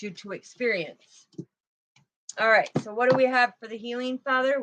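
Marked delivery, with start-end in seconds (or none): none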